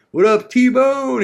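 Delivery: high, loud, slow call; hearty greeting. Retold or imitated. Crew calling out to [name], high and loud. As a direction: call out to a buddy across the room.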